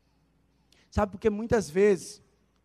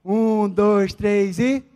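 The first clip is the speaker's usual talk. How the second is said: drawn-out, slow teasing count; slow teasing countdown; 6 dB louder, much slower than usual. Retold or imitated. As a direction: stretch each number out playfully. Counting down to hang up.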